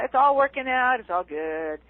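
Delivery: breezy platitude. Retold or imitated. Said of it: "You know".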